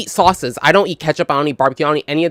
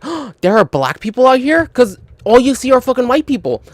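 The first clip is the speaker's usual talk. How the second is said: imitating delighted surprise, much higher; pleased surprise; 7 semitones higher than usual. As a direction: gasp then a bright, high surprised question. Mister Anderson's happy surprise retold.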